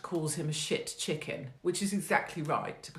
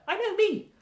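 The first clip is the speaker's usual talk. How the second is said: mock squeal; 6 dB louder than usual. Self-mocking squeal in kitchen story.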